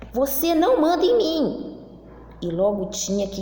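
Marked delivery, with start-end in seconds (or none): 0.1-1.8 high, loud snippy comeback; sassy retort. Acted. Story character answering back in a quarrel, high and loud. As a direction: snap back like a cheeky kid.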